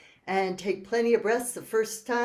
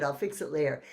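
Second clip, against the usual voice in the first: ordinary delivery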